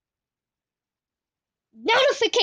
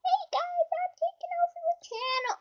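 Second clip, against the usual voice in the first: much higher, fast puppet voice; high fast toy crocodile voice; 7 dB quieter, faster than usual. Acted. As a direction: squeak it like a cheeky toy crocodile. Crocodile takes over channel.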